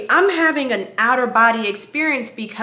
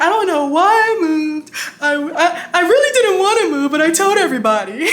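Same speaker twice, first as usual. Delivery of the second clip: high, loud mock blubbering; hammed-up tearful confession; imitated crying; 6 semitones higher, 5 dB louder, faster than usual. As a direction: blubber it out, tipping into laughter. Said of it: "I'm crying, and I was like".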